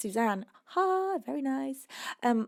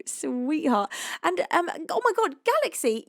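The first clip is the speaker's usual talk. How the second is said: ordinary delivery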